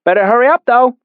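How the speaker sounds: put-on high, loud little boy voice; child calling. A narrator's reading. Young boy urging the narrator on, high and loud. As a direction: tease him to hurry, high and bright.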